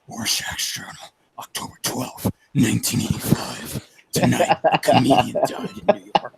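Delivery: low, raspy near-whisper of a grim journal entry; Rorschach monologue. Shown as a demonstration. Reciting the Rorschach journal for the animator, low and raspy. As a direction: rasp it low and breathy like a noir diary. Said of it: "then I did the whole monologue".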